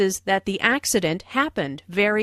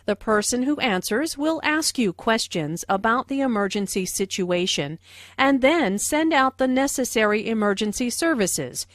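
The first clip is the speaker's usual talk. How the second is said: ordinary delivery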